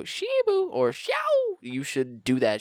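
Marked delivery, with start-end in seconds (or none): 0.0-0.7 high, loud call-out; skater call. Retold or imitated. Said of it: "if I go". 0.9-1.6 high, loud call-out; skater call. Retold or imitated. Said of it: "if I go".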